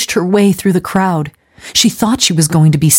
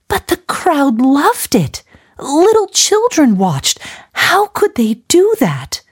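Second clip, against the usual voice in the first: high, slow tearful pleading; sobbing; 11 semitones higher, slower than usual. A narrator's reading. Gladys still sobbing as she questions Missus Lawson, slow high plea. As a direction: plead through tears, slowly. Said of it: "still sobbing".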